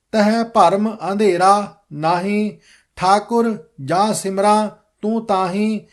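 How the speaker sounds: slow, even recitation of scripture; careful model reading. Shown as a demonstration. Teacher reading the whole shabad for students, slow careful recitation. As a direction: read the verses out slowly and distinctly. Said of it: "ਸਾਰਾ ਸ਼ਬਦ ਫੇਰ ਪੜ੍ਹਦਾ ਹਾਂ".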